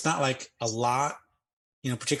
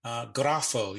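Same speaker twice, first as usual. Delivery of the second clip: slow Greek quote; slower than usual. Retold or imitated. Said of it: "It's like he says".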